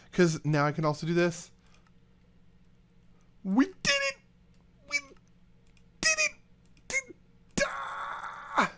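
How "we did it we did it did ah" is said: much higher squeal of victory, a cry on "ah"; squealing cheer. Real, not acted. To viewers after the build works, a high squealing cheer. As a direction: shriek with triumph.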